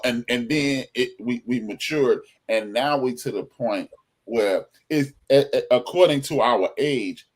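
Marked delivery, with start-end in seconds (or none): none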